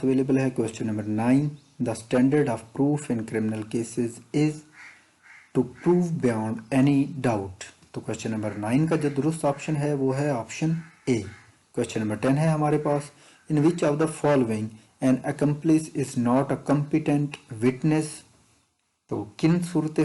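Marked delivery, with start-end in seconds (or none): 1.8-7.7 slow, deliberate reading of an exam question. Acted. Teacher reading an exam question to students, slow and deliberate. Reads MCQ question slowly. 13.5-18.1 slow, deliberate reading of an exam question. Acted. Teacher reading an exam question to students, slow and deliberate. Reads MCQ question slowly.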